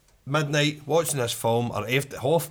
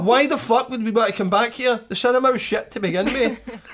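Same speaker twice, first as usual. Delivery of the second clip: angry retort; 7 semitones higher, 4 dB louder than usual. Retold or imitated. Retelling complaint to cinema staff.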